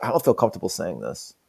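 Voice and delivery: low, hushed reluctance; uncomfortable actor. Acted. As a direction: back away from the line under your breath. Acts actor balking at the lines.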